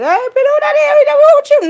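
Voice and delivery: high, loud squeal of recognition; mimicked excited greeting. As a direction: call out like a nosy, thrilled onlooker. Imitates people greeting her at door.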